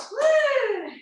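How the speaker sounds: high joyful whoop at the finish; cheer. Real, not acted. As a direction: celebrate the last second with a whoop. End of boxing set.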